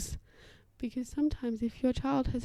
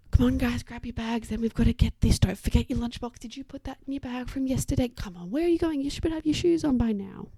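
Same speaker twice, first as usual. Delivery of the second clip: hurried, nagging parent; 3 semitones higher, 4 dB louder than usual. Acted. Parent rushing children off to school, hurried and nagging. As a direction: chivvy the kids out of the door. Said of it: "It's not the time for that voice".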